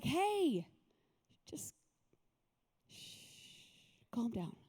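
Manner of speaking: soft whispered shush; gentle hushing. Acted. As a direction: hush it down to a whisper, then softly soothe. Jesus hushing the waves, acted.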